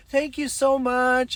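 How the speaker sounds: high, drawn-out gushing thanks; grateful woman, imitated. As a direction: mimic her effusive gratitude. Grateful woman voiced in retelling.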